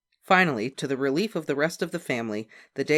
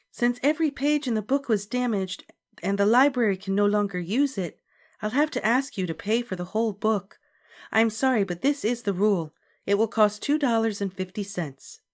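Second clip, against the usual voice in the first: higher, calm polite librarian; 9 semitones higher, 3 dB louder than usual. A narrator's reading. Librarian explaining the rule to Beezus, high and polite.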